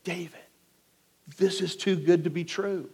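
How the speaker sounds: very soft, conspiratorial undertone; hushed urging. Acted. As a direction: tempt him quietly, so the sleeper won't wake. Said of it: "he urges David".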